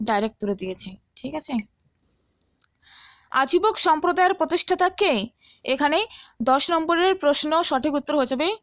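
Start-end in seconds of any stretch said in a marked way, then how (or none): none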